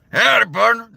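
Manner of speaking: Howdy partner as a gruff cowboy; cowboy greeting, right take. Shown as a demonstration. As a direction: land the cowboy Arthur greeting. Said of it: "There it is".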